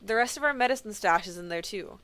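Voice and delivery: ordinary talk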